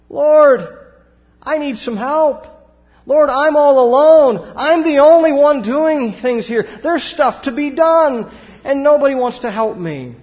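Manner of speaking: high, loud complaining plea, all alone; whiny Martha. Retold or imitated. Martha complaining to Jesus about doing all the serving, high and loud whine. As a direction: wail it out like an overworked host begging for help. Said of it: "this is what she says".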